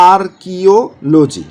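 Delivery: Archaeology drawn out very slowly; slow dictation of archaeology; slow syllable by syllable. Shown as a demonstration. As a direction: say archaeology slowly, each part separate. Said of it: "এরকম করে পড়বেন".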